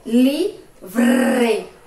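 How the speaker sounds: Livre with a light tapped R, drawn out, loud. Shown as a demonstration. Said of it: "O som foi fraco".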